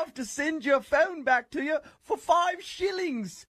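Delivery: high, slow, shouted mock British accent; shouted fake British accent. Fake hotel manager taunting an angry British guest with a shouted accent. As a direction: ham up a British accent at full volume.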